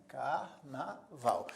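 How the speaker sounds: "Carnaval" very slowly split car-na-val; syllable by syllable. Shown as a demonstration. Teacher counting three syllables, "Carnaval" broken up slowly. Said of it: "tem três sílabas".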